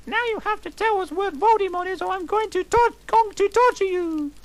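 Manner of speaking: very high, loud and fast squeaky threat; hammed-up falsetto torture threat. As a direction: rattle it off like a shrill villainess. Heroine threatens Lupin.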